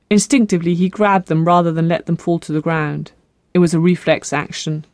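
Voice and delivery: plain reading voice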